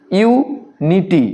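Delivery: "ইউনিটি" slowly broken as yu - niti; split into syllables. Shown as a demonstration. Teacher shows pupils another divided word, "ইউনিটি" split with a pause. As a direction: say "ইউনিটি" in separated parts, pausing after yu. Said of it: "ভাগ ভাগ করে উচ্চারণগুলো".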